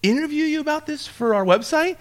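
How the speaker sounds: high, tentative ask with rising end; imitating a hopeful, careful request; polite request. Repeats the interview request.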